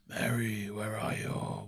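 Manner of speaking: drawn-out eerie creature call; slow monotone monster summons. Acted. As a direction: call the name like a lurking creature. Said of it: "some, like, creatures appear, and they're like".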